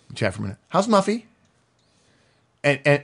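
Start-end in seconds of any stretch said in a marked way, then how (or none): 0.7-1.2 high-pitched, friendly check-in; a people person greeting a colleague, pitched well up; chatty high I. Acted. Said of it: "the high eye comes up and says".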